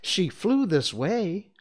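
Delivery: high, slow, loud and troubled. A narrator's reading. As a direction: insist with growing concern, drawing it out. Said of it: "becoming troubled".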